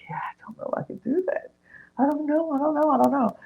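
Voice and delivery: high, whimpery repeated protest; mock crying. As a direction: imitate a tearful friend, breathy then squeaky. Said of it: "And so she's crying".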